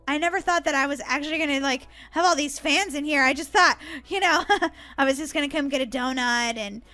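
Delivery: ordinary talk, nothing marked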